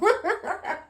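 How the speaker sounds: a sudden high laugh through tears; a shaky laugh breaking the sadness; high teary laugh. Real, not acted. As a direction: laugh off the crying, high and bright. Laugh after emotion.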